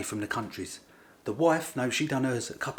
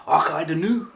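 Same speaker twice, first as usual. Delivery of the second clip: loud, raised mock-Scottish catchphrase; Scottish lingo; 5 semitones higher, 10 dB louder than usual. Englishman shows off his new Scottish roots, mock Scottish accent. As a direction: call it out in a put-on Scottish accent. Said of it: "I learned some Scottish lingo".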